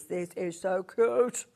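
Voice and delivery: slow, drawn-out cutesy gushing. Real, not acted. Presenter gushes over border fabric, cute voice. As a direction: stretch it out as if cooing over something adorable. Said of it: "that for your voice when it goes cute".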